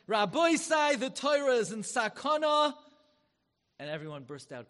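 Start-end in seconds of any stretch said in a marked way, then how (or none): 0.1-2.8 very high, loud desperate plea; anguished outcry. Retold or imitated. Rav Zalman Sorotzkin crying out to the rabbis in alarm, very loud. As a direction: cry out in alarm to the assembly.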